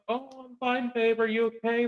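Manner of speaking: high, soft, sugary put-on voice; mock caring spouse. Mocking a caring spouse after a crash, high and soft. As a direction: coo it high and gentle. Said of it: "It's not".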